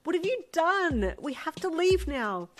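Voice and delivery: high, loud, slow urgent command; angry urgency. A narrator's reading. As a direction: demand it fiercely. Urgent quarrel.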